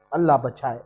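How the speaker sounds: soft, low murmured prayer for protection; hushed plea. Acted. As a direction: breathe it as a frightened prayer. Preacher's fearful plea after grave.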